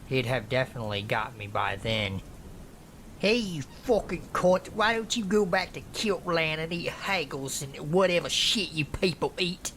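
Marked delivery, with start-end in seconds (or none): 3.2-9.7 high, put-on jeering voice; acted scornful tirade, pitched up; mocking. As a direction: taunt as a hostile heckler. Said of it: "He started mocking the Scottish accent".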